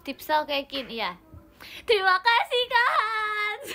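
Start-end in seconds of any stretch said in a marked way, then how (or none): 1.9-3.7 very high, loud shout-out; teriakan terima kasih. Real, not acted. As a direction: scream thanks to the gift-giver. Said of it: "ntar aku teriakin". (teriak melengking berterima kasih)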